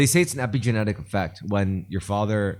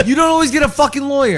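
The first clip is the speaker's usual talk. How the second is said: yelled, much higher and louder; shouting; 9 dB louder than usual. Real, not acted. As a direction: shout it in frustration. Heated argument, shouting.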